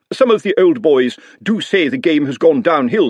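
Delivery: eager to please; a lightly voiced fawning servant; Otomy's voice. Otomy voiced in read story.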